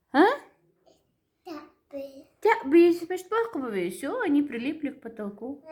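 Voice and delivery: squeaky helium-high voice. Real, not acted. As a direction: chipmunk voice from helium. Helium voice continues.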